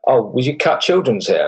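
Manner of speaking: ordinary talk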